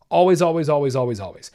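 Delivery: insistent always, fading out; emphatic repetition. Real, not acted. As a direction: hammer always then let it trail off. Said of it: "emphatic repetition".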